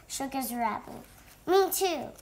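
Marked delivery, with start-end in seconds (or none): 1.5-2.1 higher, keen chiming in; eager agreement. A narrator's reading. Cobra joins the party plan, eager. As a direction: pipe up to join the plan.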